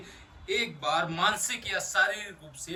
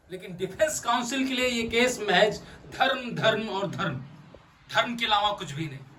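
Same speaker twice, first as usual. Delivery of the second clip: raised, higher courtroom attack; forceful accusation; 3 semitones higher, 5 dB louder than usual. Acted. Lawyer accuses defense of using only religion, forceful accusation. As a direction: hammer each repeated word at the court.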